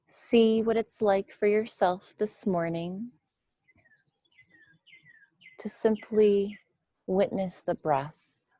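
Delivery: measured meditation voice with long, spacious pauses; guided meditation. Real, not acted. Teacher guiding listeners to witness the breath, guided meditation. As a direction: guide them gently through the breath, leaving room between phrases.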